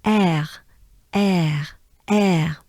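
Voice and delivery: "air" as French èr with a throaty r. Shown as a demonstration. Teacher drilling the sound for learners, French "air". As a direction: repeat "air" slowly with a French r. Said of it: "So here we have".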